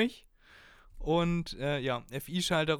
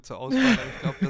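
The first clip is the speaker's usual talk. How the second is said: laughing; 9 dB louder than usual. Real, not acted. Laughing while naming glue gun.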